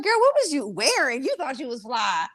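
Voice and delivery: high, incredulous ribbing; teasing disbelief. Acted. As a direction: roast your younger self affectionately. Teasing her past self.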